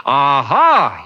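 put-on loud triumphant bark of a drunk; roared. A narrator's reading. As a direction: roar it out at the foreigner. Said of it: "he roared".

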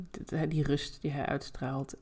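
ordinary delivery

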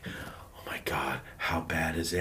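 very soft, low whispered gossip; hushed worry. Retold or imitated. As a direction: imitate classmates whispering about an accident. Imitating overheard worried classmates.

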